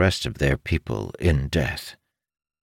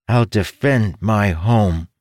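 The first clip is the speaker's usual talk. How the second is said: slow, louder firm vow; resolute young son; 5 semitones higher, 4 dB louder, slower than usual. Acted. Young son vowing to defend home, resolute. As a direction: vow it like a small brave hero. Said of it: "he said resolutely".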